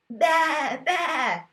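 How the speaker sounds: hammed-up sheep noise for a child; sheep baa. Shown as a demonstration. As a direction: bleat it out like a playful sheep. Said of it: "making the sounds the animals make".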